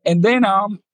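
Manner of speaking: ordinary talk, nothing marked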